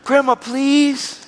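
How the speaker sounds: put-on high, drawn-out child's plea; pleading granddaughter. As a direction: stretch it out like a begging kid. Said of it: "The granddaughter".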